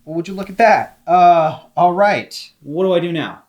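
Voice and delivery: ordinary delivery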